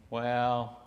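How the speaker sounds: usual speaking voice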